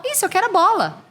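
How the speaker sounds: put-on high little-kid voice; imitating a small child. Child confirming to mother, high kid voice. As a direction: chirp it back like a toddler.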